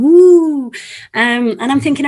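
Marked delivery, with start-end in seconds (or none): 0.0-0.7 high, loud drawn-out "woo"; children's spooky woo. Retold or imitated. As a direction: imitate a crowd of children wooing at a ghost. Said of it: "all the children are going".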